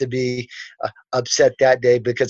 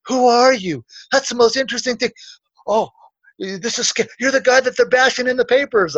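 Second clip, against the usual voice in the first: high-pitched, astonished questioning; amazed teachers; 8 semitones higher than usual. Retold or imitated. Teachers come up to him afterward, amazed. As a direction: imitate excited teachers meeting a notorious stranger.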